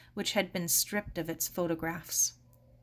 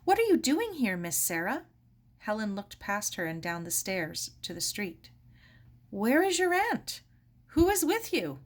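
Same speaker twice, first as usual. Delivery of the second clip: anxious questions; 2 semitones higher than usual. Acted. Helen anxiously questioning Sarah on the doorstep. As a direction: press her with worried questions.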